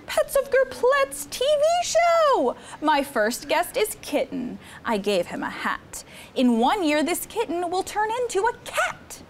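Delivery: put-on lively read-aloud for children; storybook reading. Counselor reading Cat in the Hat book to campers, lively storytime. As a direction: read the rhymes to the kids with bounce.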